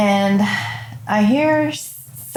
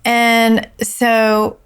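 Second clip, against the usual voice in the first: normal talking voice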